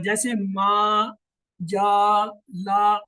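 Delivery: ما جا لا each vowel stretched long and loud; long drawn vowels. Shown as a demonstration. Teacher drawing out ما جا لا for students. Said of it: "ایک لفظ کے برابر آواز کھینچی جائے گی".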